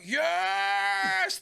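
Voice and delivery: high, loud, drawn-out cheer; big imitated yell of triumph; acted triumphant cheer. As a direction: cheer like a thrilled cinema audience. Acts out audience cheering in cinema.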